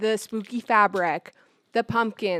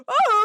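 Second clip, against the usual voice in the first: high, loud creature wail; Chewie impression howl; 12 semitones higher, 5 dB louder than usual. Shown as a demonstration. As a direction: howl out a rough Chewbacca impression. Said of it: "Let me do my impression".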